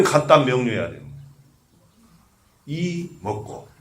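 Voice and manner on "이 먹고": long, hushed "이" before "먹고"; drawn-out 이. Shown as a demonstration. Monk demonstrating the hwadu to students, drawn-out "이". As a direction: stretch "이" slowly and softly, then drop "먹고". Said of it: "이렇게 해야 되는".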